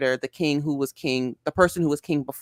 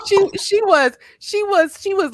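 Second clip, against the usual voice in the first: words broken by laughter; laughing through the words; 6 dB louder than usual. Real, not acted. Laughing about the story.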